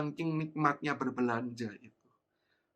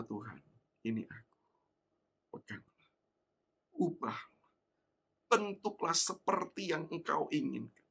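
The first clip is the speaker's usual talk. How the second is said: quiet prayer; 4 dB quieter than usual. Acted. Prayer of surrender.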